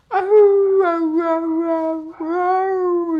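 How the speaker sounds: loud high mock dog howl. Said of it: "they're both going".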